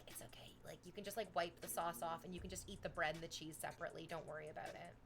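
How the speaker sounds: soft, hushed comforting aside; low-volume, gentle retold reassurance; quiet reassurance. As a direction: imitate a nanny soothing kids under her breath. Nanny quietly comforts the kids.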